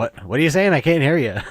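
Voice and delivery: loud, high-pitched shout as if straining to hear; mock hard-of-hearing complaint. Co-host imitating people who tell the mumbler to speak up, mock shouting. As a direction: play someone yelling at a mumbler to speak up. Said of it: "told to speak up so many times".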